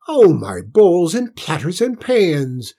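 high, loud, drawn-out exclamation; astonished cook. A narrator's reading. Cook marvels at the washed plate, exclaiming. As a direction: wail it slowly in amazement.

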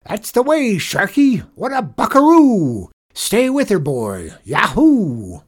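high, loud rodeo cheering; shouted. A narrator's reading. Janitor cheering on the boy rider, shouted. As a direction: holler encouragement like a cowboy. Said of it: "he shouted".

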